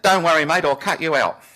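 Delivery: loud, high-pitched retold call; shouted reassurance. Neighbour stooping to the man under the tree, shouted reassurance. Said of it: "and he said".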